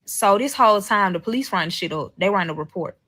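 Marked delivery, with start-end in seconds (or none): none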